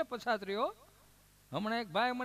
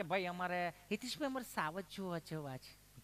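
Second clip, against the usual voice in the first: a lightly voiced visitor, crisp careful Gujarati; prim city visitor; 2 semitones lower, 6 dB quieter than usual. City visitors ask the storyteller to see lions, mock prim. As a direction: imitate a townsman picking each word neatly. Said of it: "Gujarati ચીપી ચીપીને બોલે".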